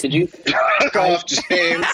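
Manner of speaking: slow, laughing half-shout; cracking up while yelling at James; laughing outburst. Real, not acted. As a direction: blurt it out through real laughter.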